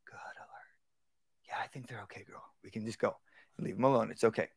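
whispered calm praise. Shown as a demonstration. Trainer whispering reassurance to the dog. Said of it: "I just encourage".

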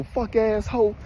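high-pitched furious snap; snapping. Retold or imitated. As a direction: lash out at her, pitch way up. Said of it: "Boy, I snapped on this hoe".

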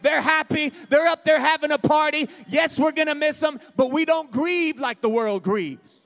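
loud, high celebrating preacher; preaching at full force. Real, not acted. Preacher telling mourners the saved dead are happy, shouting joyfully. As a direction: lift the church with a joyful shout. Preaching climax, music under.

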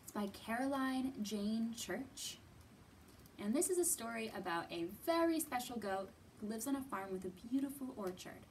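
usual speaking voice

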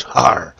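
loud hearty pirate "Har!"; pirate laugh. Pretend. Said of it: "that pirate voice".

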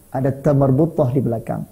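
ordinary delivery

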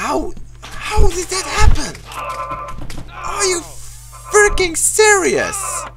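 incredulous high squeal; high-pitched outrage. Real, not acted. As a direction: protest in a very high voice. Outraged at game death.

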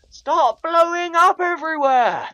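high-pitched, slow, loud scream; panicked yell. Real, not acted. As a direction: shriek at them to stop. Yells at exploding game mobs.

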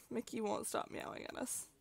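ordinary delivery

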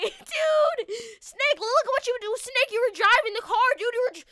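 loud role-played outrage; shocked accusation. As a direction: pin the blame on your friend in mock shock. Blaming friend for the crash.

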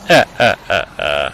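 low, staccato mock cackle; deep, spaced-out victory laugh; gloating laugh. Gloats after killing the noob.

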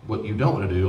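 ordinary delivery

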